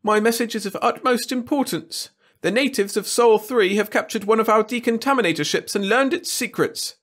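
high, anxious courtier voice; Wirt's whiny voice. A narrator's reading. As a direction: plead nervously with the king. Alien lord Wirt voiced.

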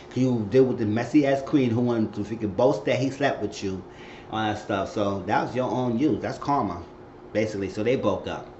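ordinary talk, nothing marked

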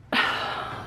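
quiet unvoiced sigh of dismay; soft sigh. Real, not acted. Crafter to viewers, sighing before the table collapse. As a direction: breathe out before bad news.

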